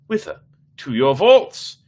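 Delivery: pressing to go at once; lightly voiced tipsy eagerness; eager drunk friend. Fortunato eager to go.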